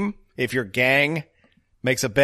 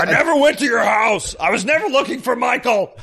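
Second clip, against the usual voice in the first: high, hammed-up indignant yell; mock yelling; 9 semitones higher than usual. Imitating Vin denying it all to Tran, mock yell. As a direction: shout the denial like an action hero. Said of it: "and then he yells".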